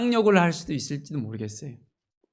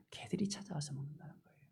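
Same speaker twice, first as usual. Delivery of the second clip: whisper; 19 dB quieter, faster than usual. Real, not acted. Preacher drops voice for emphasis.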